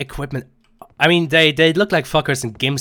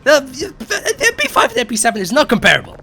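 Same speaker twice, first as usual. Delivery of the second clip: laughing; 11 semitones higher, 4 dB louder than usual. Real, not acted. Laughing while arguing.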